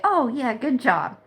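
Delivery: mock "good job" with a roll of the eyes; mock approval. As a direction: pretend to cheer it on, dripping sarcasm. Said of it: "sit by my hands folded and say".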